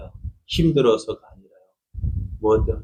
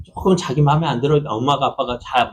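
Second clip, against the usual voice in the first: ordinary delivery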